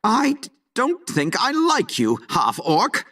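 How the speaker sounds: halting, stuttered start to a retort; stuttering. Acted. Timid rival telling the half-orc of dislike, stuttering. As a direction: stammer on the first words. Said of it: "yanked a stuttering fool".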